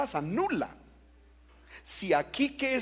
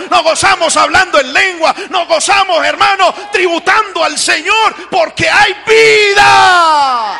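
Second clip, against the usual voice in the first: real preacher's shout, building louder and higher; rally the congregation at full force; high, loud cries with long-held final words; impassioned preaching; 10 semitones higher, 17 dB louder than usual. Real, not acted.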